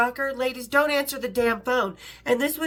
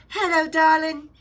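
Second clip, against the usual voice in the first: slow, sweet English lady voice, pitched up; mock English greeting; English accent; 4 semitones higher, 6 dB louder than usual. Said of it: "tiny little English voice".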